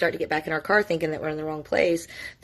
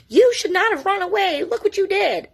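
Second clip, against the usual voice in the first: high, louder mock scolding; mock mom yelling; 14 semitones higher, 5 dB louder than usual. Mom scolding her runaway daughter, high mock yell. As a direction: imitate an angry mom telling off her child. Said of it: "Please don't yell at her".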